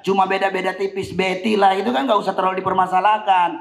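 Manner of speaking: ordinary talk, nothing marked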